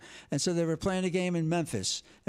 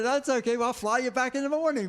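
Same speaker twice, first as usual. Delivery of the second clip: breezy generous reassurance; 7 semitones higher, 3 dB louder than usual. Retold or imitated. Quoting [name]'s offer.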